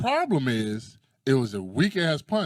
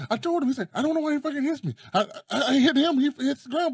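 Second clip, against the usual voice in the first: very high-pitched mock disbelief; high, hurried comic retelling; baffled puncher; 13 semitones higher, faster than usual. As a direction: imitate his incredulous protest, voice way up. Acting out the Spanish guy.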